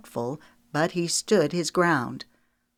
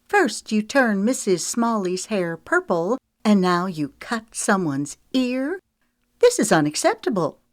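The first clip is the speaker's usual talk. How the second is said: high and louder, for the whole salon; scolding the stylist so the salon hears, loudly; raised voice; 6 semitones higher, 4 dB louder than usual. A narrator's reading. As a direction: broadcast the complaint to the room. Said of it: "raised her voice loud enough".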